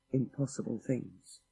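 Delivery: ordinary delivery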